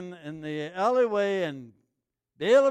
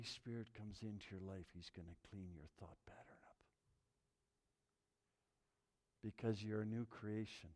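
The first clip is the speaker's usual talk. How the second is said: soft, much quieter sermon voice, sinking into whispers; hushed preaching; 9 semitones lower, 13 dB quieter than usual. Acted. Pastor to his church about the Holy Spirit, hushed and whispery. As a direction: draw the congregation close with a near whisper.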